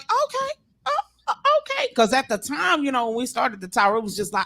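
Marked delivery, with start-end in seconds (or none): 0.0-1.9 very high, hesitant squeak; taken aback. Retold or imitated. Host hearing her track for the first time, taken aback. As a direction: caught off guard, stalling. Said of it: "I was like".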